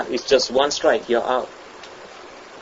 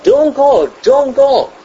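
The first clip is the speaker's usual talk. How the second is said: urgent warning; 10 semitones higher, slower than usual. Retold or imitated. Townspeople warning a driver about the fog, high urgent pleas. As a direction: call out the warning to stop him. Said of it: "the people said".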